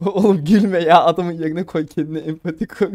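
talking through laughter; trying to speak while cracking up; laughed-out plea; laughing. Real, not acted. Laughing while mock-scolding the laughter.

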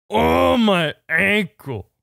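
loud, slow, drawn-out groaning impression; Andre the Giant. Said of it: "You sound like Andre the Giant".

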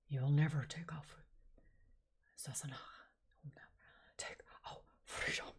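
whispered Scottish warm-up line; Scottish accent. Shown as a demonstration. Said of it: "Read it in a Scottish accent".

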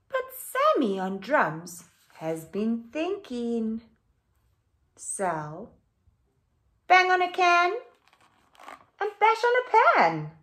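animated picture book read-aloud; reading to children. A narrator's reading. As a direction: read it out lively for the class.

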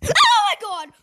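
high, loud startled cry; real fright. Real, not acted. Player really scared by the game, startled cry. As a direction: yelp it in sudden shock. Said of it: "That really scared me".

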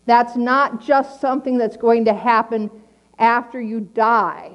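usual speaking voice

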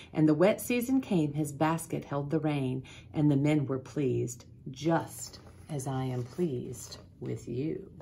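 usual speaking voice

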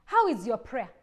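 usual speaking voice

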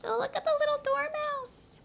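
very high cooing voice. Real, not acted. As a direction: coo at it like a tiny pet. Cooing over tiny toy.

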